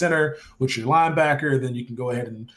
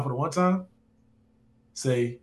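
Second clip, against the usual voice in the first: ordinary delivery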